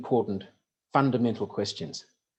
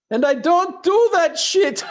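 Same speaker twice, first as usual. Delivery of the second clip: put-on much higher, slow, laughing through a Russian accent; 9 dB louder, slower than usual. Sergei's punchline to the crowd, laughing in a Russian accent. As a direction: crack up in Russian-accented glee. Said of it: "he said it with a Russian accent".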